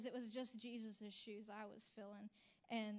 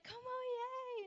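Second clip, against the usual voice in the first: "Como ie?" sung out high, slow and loud; high, stretched, thrilled "Como ie?"; excited greeting; 13 semitones higher, 5 dB louder, slower than usual. Retold or imitated. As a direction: call out "Como ie?" in delight. Joyful greeting to the beaming boy.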